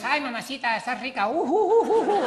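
high laughing catcall with loud hoots; high mock catcalls and whoops; dwarves catcalling. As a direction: hoot at the dancers, very high. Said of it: "Y aquellos".

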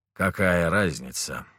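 put-on slow, bitter drunken lament. A narrator's reading. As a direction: say it with heavy bitterness. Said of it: "горько произнёс он".

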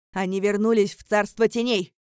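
loud, forceful insistence; shouted. A narrator's reading. Father insisting the demons are gone, shouted. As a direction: keep shouting it across the square. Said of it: "выкрикнул мой отец".

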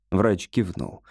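ordinary delivery

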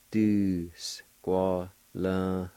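"Du squalin" stretched out very slowly; slow syllabified. Shown as a demonstration. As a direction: drag out every part of "Du squalin". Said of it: "It's pronounced like this".

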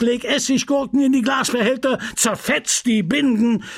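the pharmacist fuming at his apprentice; a lightly voiced furious old apothecary; angry apothecary. Homais ranting at Justin.

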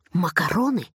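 offering it carefully, pitch settling at the end; tentative suggestion. A narrator's reading. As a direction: float the word as a bright idea. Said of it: "как будто к нему пришла гениальная мысль". (осторожное предложение)

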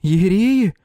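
high frightened voice. A narrator's reading. Frightened neighbour exclaims.

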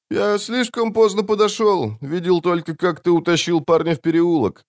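high-pitched character voice; assassin's friend. A narrator's reading. Friend tells the assassin he arrived late, high-voiced. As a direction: explain it high, settling slightly.

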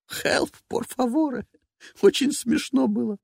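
slow, high mock cry for help; teasing parody of the scream; mocking imitation.

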